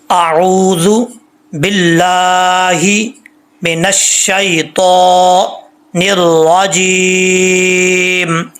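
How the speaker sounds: slow, drawn-out tilawat chant; measured Quranic recitation. Shown as a demonstration. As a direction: recite it slowly, stretching each syllable. Recites ta'awwuz in Quran style.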